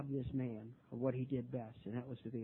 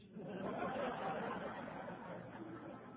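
soft breathy chuckle; quiet laugh; 6 semitones higher, 8 dB quieter than usual. Real, not acted. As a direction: laugh under your breath at the irony. Laughs at borrowing money story.

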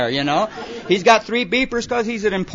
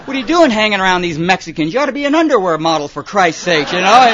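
vain alkie in mirror; 2 semitones higher, 6 dB louder than usual. Retold or imitated. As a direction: gush at your own reflection. Alkie admiring himself in mirror.

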